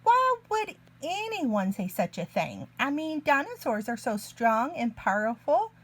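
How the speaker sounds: ordinary delivery, nothing marked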